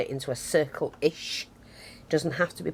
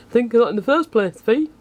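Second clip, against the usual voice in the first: higher, louder, laughing tell-off to herself; mock self-scolding; 7 semitones higher, 7 dB louder than usual. Real, not acted. Crafter teasing herself for a clumsy idea, laughing mock scold.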